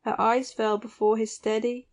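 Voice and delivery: ordinary delivery, nothing marked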